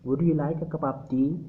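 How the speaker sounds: slow question, tea rising at the end; rising intonation. Shown as a demonstration. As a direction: lift the voice on tea. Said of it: "अपनी आवाज़ की pitch को थोड़ा सा ज़्यादा कर देते हैं". (tea पर आवाज़ ऊपर, धीमी रफ़्तार)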